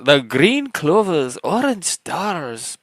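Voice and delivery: put-on high, slow, sing-song Irish accent, like the leprechaun in an old ad; naming the marshmallows like the Lucky Charms leprechaun, in an Irish accent; mock Irish leprechaun accent. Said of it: "they'd all be in an Irish accent".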